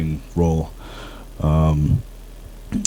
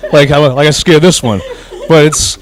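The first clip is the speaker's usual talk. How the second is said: laughing while talking; 9 semitones higher, 4 dB louder than usual. Real, not acted. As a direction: laugh through the words. Laughs after joke about scaring.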